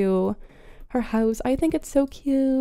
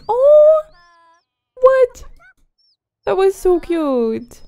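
high, loud startled squeal; surprised squeal; 7 semitones higher, 6 dB louder than usual. Real, not acted. Player startled by a chick's antics, high squeal. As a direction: jump at something unexpected.

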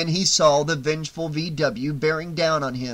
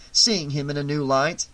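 ordinary delivery

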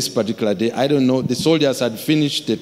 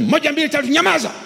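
shouted command in Swahili; much faster than usual. Retold or imitated. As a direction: bark the count at unruly soldiers. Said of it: "Then I shouted".

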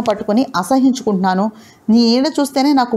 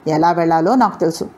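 plain reading voice

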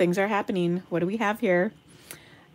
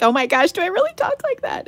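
mock shocked self; 11 semitones higher, faster than usual. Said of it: "I'm just like".

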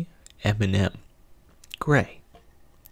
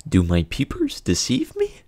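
hammed-up amazed exclamation, pitched higher; mock astonishment; 7 semitones higher than usual. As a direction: play it as theatrical disbelief. Dad marvels at gray M and M.

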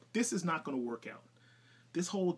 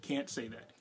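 usual speaking voice

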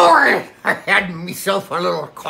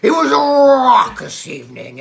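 loud and emphatic; 7 semitones higher, 5 dB louder than usual. Acted. Pirate building up his tale.

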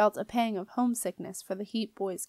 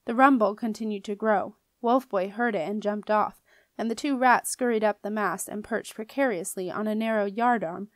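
plain reading voice

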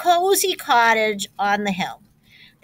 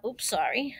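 plain reading voice

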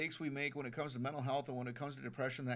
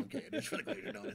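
fast, breathy giggling confession; sheepish laughing confession; laughing admission; 4 dB quieter, faster than usual. Real, not acted. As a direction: own up while cracking up. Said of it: "It's not for the Glacier Donut".

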